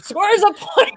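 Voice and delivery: host announcer impression; Jeff Probst voice. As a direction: play it like a TV host. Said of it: "in the words of Jeff".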